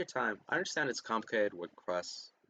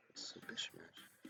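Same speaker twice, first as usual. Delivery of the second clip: whispered; 7 semitones lower, 17 dB quieter, slower than usual. Acted. Trailing off under breath.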